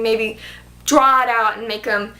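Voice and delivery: ordinary delivery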